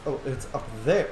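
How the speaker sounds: normal talking voice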